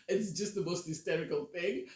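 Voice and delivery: ordinary talk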